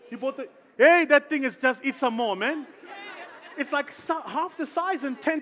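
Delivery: loud, high, indignant exclaim; half-laughing indignant shout; excited outburst. Retold or imitated. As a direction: blurt it out in mock outrage. Outraged aside about biscuits.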